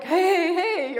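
husband calling hey, hey. Retold or imitated. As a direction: announce yourself loudly down the hall. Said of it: "or something like that".

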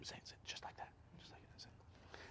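hushed, barely voiced urging; whispered coaching. Coaching the messenger on what to say, whispered. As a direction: whisper it like a private instruction.

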